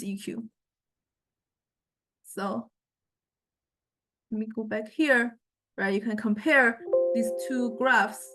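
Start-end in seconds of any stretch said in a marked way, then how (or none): none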